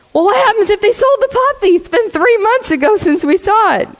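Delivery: high, rushed tearful whine, imitating; mock crying. Husband frets to his wife about the puppy, mock crying. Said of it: "the husband's, like, crying".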